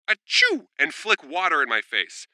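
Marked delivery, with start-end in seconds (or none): 0.1-0.7 high, loud mock "Achoo!"; fake sneeze. Mom faking a sneeze at her son, loud and high. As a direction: pretend to sneeze playfully. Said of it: "she would turn to me and go".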